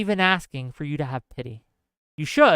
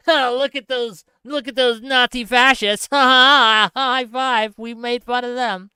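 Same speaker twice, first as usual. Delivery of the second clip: higher, hammed-up smug gloat; mocking gloater voice; 4 semitones higher, 3 dB louder than usual. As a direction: parody a gloating poster. Mocking gloating online posters.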